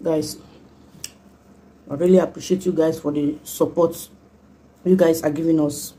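talking between bites; chewing food while talking. Real, not acted. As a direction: mouth partly full. Seen 2: Eats from hand at -4; lips close, chewing between phrases throughout.

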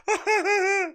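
high, soft put-on laugh; forced fake laugh. Man keeping a good face on it, fake laughing. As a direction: imitate laughing to cover rage. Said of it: "he's trying to keep a good face on it".

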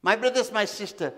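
ordinary delivery

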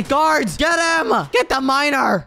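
high, shouted orders; urgent command. Real, not acted. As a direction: call the troops to attack, pitch way up. Commanding guards against intruder.

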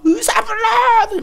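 high, drawn-out shout; acted-out frantic yell; screaming. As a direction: holler for help like a woman in labour. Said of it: "소리를 지르고".

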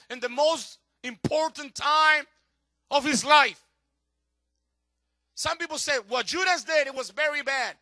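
loud, high, ringing sermon delivery; full-force preaching. Acted. Pastor to congregation on Peter's betrayal, preaching loudly. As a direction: preach it out to the back row.

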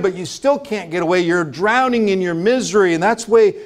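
ordinary talk

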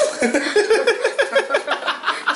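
sudden high laughing fit; real delighted laugh; high burst of laughter. Real, not acted. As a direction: crack up at the joke. Laughing at cider remark.

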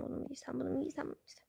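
whispered dithering over clothes. Acted. Girl choosing clothes at night, whispering to herself. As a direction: murmur the choices under your breath.

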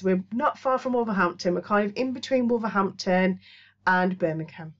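usual speaking voice

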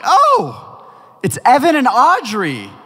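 high, loud exclamation of recognition; delighted surprise. Retold or imitated. Recognising teens from youth group at the door, happily surprised. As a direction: act out happily spotting familiar visitors.

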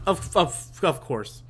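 ordinary talk, nothing marked

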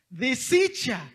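slow, loud lament; dismayed exclaim. Real, not acted. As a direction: stretch the word out in dismay. Dismay at the wrecked box.

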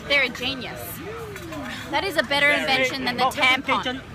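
ordinary delivery

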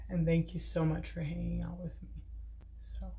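soft whispered thanks; whispering. Real, not acted. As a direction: whisper so no one wakes. Thanks while others sleep.

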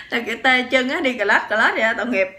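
laughing while describing the numb-legged shuffle. Real, not acted. Telling viewers about the limping husband, laughing. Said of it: "mình thấy lúc đó cũng mắc cười".

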